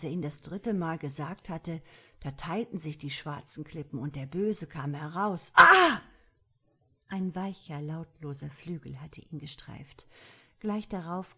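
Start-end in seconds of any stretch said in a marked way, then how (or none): 5.5-6.0 sudden high shriek of fright; a loud frightened cry breaking the reading; startled cry. A narrator's reading. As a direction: gasp out a sharp cry of shock. Storyteller cries out in fright.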